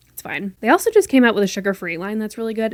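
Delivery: ordinary delivery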